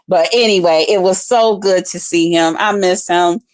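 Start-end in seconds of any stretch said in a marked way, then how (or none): none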